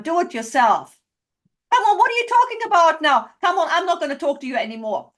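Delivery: high, loud, rapid-fire bickering; loud, hurried quarrelling outburst; mock quarrel. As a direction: act out an exasperated row at full tilt. Acting out an escalating couple's quarrel.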